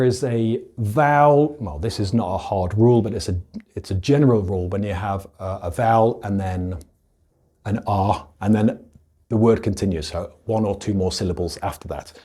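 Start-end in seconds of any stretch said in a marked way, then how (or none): none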